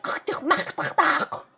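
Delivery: hammed-up guttural fake German; mock German. Streamer faking fluent German for chat, mock German. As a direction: spit out harsh throat-clearing mock German. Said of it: "go somewhere and be like".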